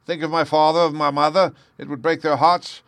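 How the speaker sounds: very high, loud, frantic pleading; panicked wail climbing in pitch; terrified shrieking. A narrator's reading. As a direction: beg desperately for mercy. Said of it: "he shrieked".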